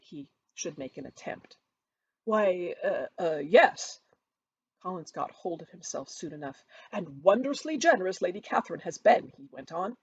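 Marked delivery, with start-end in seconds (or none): 2.2-4.0 stammering, louder; flustered Collins. A narrator's reading. 6.9-9.3 louder, fawning; gushing Collins. A narrator's reading.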